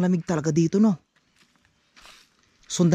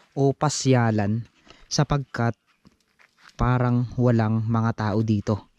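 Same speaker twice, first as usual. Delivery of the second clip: low, slow, measured storytelling voice; explorer's narration; 6 semitones lower than usual. Real, not acted. Explorer introducing an abandoned resort to viewers, measured narration. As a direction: narrate it slowly and low, in deliberate phrases.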